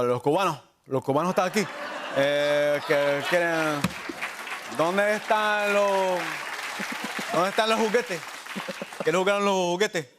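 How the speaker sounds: Cuban Spanish, swallowing the consonants; Cuban Spanish accent. Shown as a demonstration. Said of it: "hover around the consonants".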